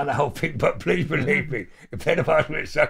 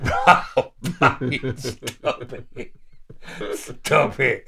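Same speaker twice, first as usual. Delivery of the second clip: laughing; 5 dB louder, much slower than usual. Retold or imitated. Laughing, mock pleading.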